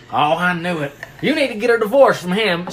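hammed-up Texas dad; Texan country accent. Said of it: "he's got a big c-, country accent".

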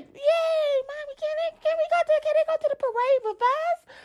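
put-on high, eager child voice; excited little daughter. Daughter asking mommy to bring them along, high excited child voice. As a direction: squeal it like a thrilled kid.

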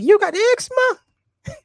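put-on very high, slow, drawn-out kid voice; little boy on Vine. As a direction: stretch it like a toddler savouring the question. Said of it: "He's like".

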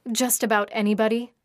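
ordinary delivery

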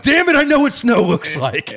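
high, exasperated retort; insist, fed up and indignant; exasperated outburst. Retold or imitated. Retold heated argument about snow.

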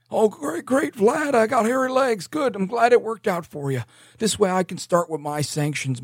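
high-pitched doddering mock voice; Biden. Biden relieved at Vlad's news, high mock voice. Acts Biden answering Vlad.

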